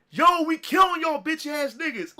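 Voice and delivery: high, loud imitated shout; retold trash-talk yell. Cousin upstairs taunting players below, imitated yell. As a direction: holler it like calling down the steps. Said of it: "yelling down the steps".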